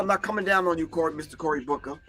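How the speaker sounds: ordinary talk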